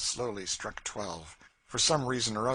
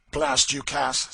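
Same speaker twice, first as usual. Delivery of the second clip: louder, snapping; a mild curse hurled at her; cursing Legree; 5 semitones higher, 10 dB louder, slower than usual. A narrator's reading. Legree curses Cassie after the fright, angry.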